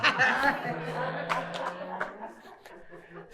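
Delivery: a long, high, helpless laugh at the punchline; hearty laughter. Real, not acted.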